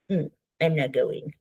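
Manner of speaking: put-on sulky, low, soft child voice; adaptive child. Shown as a demonstration. Therapist demos the adaptive child refusing to go, sulky and soft. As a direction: pout it out like a sulking kid. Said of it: "And I do it like that".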